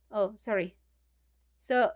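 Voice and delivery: usual speaking voice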